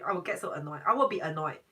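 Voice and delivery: usual speaking voice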